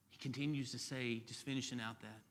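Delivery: ordinary talk, nothing marked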